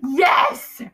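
loud, gleeful cheer; delighted shout. Real, not acted. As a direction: yell it in delight.

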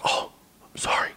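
whispered apology. Retold or imitated. Cop apologising to the dad, whispered. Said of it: "And he was like".

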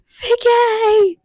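high, loud mock yell of Vicky; imitating Stephen's yell. A fan imitating Jeremy's yell at Vicky. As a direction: belt the name out like the actor did. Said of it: "He says".

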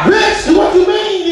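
furious mock yell at the baby mama; outraged shout. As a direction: explode at her. Outraged reaction to ex.

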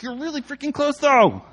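high backseat protest, rising in volume; complaining kid. Retold or imitated. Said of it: "kids in the back seat".

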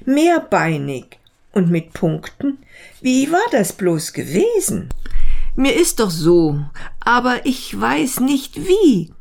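lightly voiced beetle, groping for a memory; puzzled beetle. Beetle trying to recall something, lightly voiced and puzzled.

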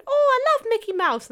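put-on very high, gushing little child; high kid voice. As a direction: squeal it like an excited kid.

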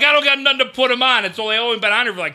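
high, loud defensive holler; retold yelling back. Son defending the burnt steaks to his dad, yelling back. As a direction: imitate yourself yelling back in protest. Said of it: "yelling back".

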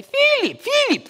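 high, loud Polish builder calling; Polish accent. Retold or imitated. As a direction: call out like Gregor in a Polish accent. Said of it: "That's how we speak".

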